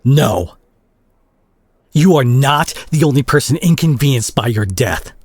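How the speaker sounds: loud and high, cutting them off; betrayed friend snapping back, high and loud; angry outburst. Acted. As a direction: shout them down in hurt fury. Confronting a friend who lied.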